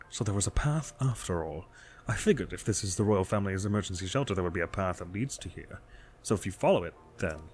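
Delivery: soft, hushed thinking aloud; musing investigator. Acted. Investigator finding a path to the castle, quietly musing. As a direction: quietly work it out to yourself.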